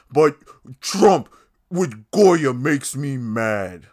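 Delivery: a sarcastic crybaby tantrum, dragged out; mocking whiny anger. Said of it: "he wanted to make sure he got it out".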